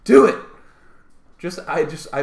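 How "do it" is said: loud, relishing egging-on; hammed-up director's shout; screaming director. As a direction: goad the actor loudly. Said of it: "they're just screaming at him".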